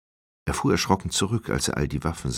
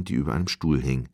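ordinary delivery, nothing marked

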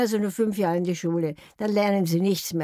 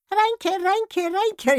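cheerful parrot call; 11 semitones higher, much slower than usual. Retold or imitated. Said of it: "rief der Vogel fröhlich".

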